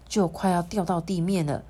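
plain reading voice

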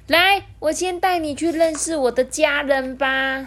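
high, cheery penguin invitation; cheerful giggly penguin. A narrator's reading. Penguin invites friend to meet family, gleefully. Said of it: "笑嘻嘻地说".

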